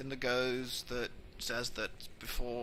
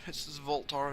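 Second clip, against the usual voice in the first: normal talking voice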